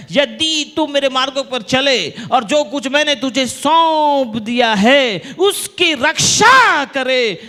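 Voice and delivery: forceful preaching. Real, not acted. Preacher proclaiming God's conditions to congregation, forceful preaching. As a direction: preach it hard, building to a loud peak.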